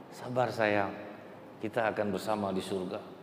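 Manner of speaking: put-on mother calming her child before death, soft and tender; tender comfort. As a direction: hush him gently toward heaven. Mother soothing third child.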